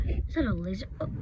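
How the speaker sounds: normal talking voice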